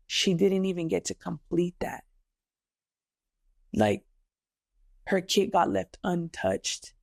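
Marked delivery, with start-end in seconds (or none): none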